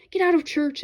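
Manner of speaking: usual speaking voice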